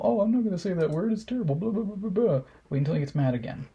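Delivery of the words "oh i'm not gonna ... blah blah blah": high, fast mocking whine; high mocking parody of his pious voice; mocking impression. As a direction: sneer his fake reluctance, high and quick. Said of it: "now he's back on the whole".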